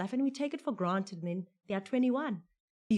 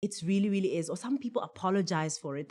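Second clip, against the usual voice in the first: normal talking voice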